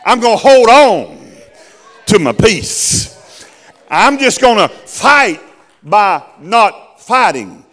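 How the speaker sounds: high, loud whooping sermon cadence; full-force preaching. Real, not acted. Preacher whooping the climax at the congregation. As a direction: ride the rhythm all the way up.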